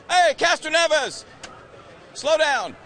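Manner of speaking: loud, high holler down the grid; calling out. Real, not acted. Reporter hails a driver down the grid, calling out. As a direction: yell it slow and drawn out after someone.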